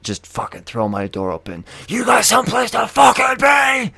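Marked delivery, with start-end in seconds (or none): 1.9-3.9 high, loud furious bellow; top-of-lungs yell. Retold or imitated. Driver yells at old folks from open car door, screaming. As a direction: scream it so it echoes down the block. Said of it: "fucking top of my lungs".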